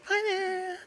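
very high, squeaky little fairy; squeaky sprite voice. Acted. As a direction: chirp it like a tiny sprite. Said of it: "talks to you in a quick, squeaky voice".

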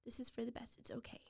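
whispered, hushed comfort; soft reassurance. Retold or imitated. Birth mom comforting the caller, whispered reassurance. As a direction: whisper it's okay to soothe her. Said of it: "And she was going".

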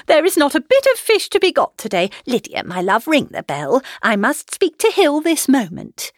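high, loud, flustered mother; excitable Mrs Bennet. A narrator's reading. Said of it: "Missus Bennet's eyes sparkled".

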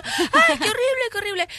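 high, hammed-up panic cry; mock terrified. Swimmers flee the water, mock terrified. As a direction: start high like a frightened bather. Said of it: "sale la gente corriendo del agua y asustadísima".

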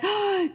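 high mock-horrified gasp; shocked gasp. Mom imitating her daughter's shocked intake of breath. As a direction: gasp like a scandalized child.

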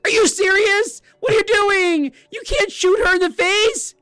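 high, loud squeaky Morty-like shriek; outraged talking gun. Retold or imitated. The talking gun scolding the player for killing a kid, high outraged shriek. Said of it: "the gun was like".

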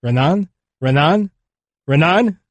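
calling out. A narrator's reading. Survivor calls for his missing friend, restrained calling out. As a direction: call the name with a rising, searching lift. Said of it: "He calls out for his radio colleague".

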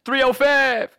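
high, slow shout with "five" as "fev". Retold or imitated. Comic mimicking the rapper's odd catchphrase, high drawn-out "fev". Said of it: "it's weird".

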